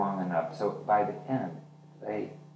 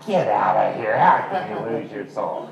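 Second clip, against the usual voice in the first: loud disbelief; 8 dB louder than usual. Retold or imitated. Retold disbelief at her claim.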